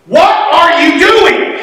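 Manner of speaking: high, drawn-out exasperated demand; mock exasperation, each word stretched out high; frustrated mother. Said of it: "Mom was just so frustrated".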